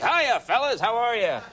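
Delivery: high, jaunty, one-of-the-guys hello; host greeting the firemen, overly chummy. Retold or imitated. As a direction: play it like an eager buddy. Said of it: "and I'm like".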